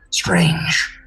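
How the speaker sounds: low, unvoiced rasp of a suspicious mind-creature; whispery alien creature. Captor confronting the Golden One, cold whisper. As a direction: hiss it out cold and accusing.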